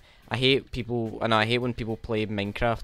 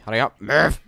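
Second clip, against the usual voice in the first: hurrying someone; 2 semitones higher, 7 dB louder than usual. Real, not acted. Urging minecart, creeper chasing.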